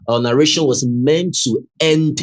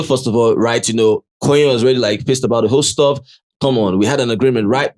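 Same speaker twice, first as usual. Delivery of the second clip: normal talking voice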